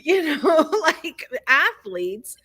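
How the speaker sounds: laughing through the words; a real laugh breaking into the words. Real, not acted. A woman delighted by the athletes' tears, laughing.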